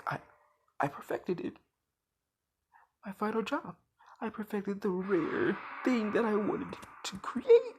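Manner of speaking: a breathless scientist delighted with his creation; gleeful mad scientist. Acted. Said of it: "he is freaking out, and he's happy about it".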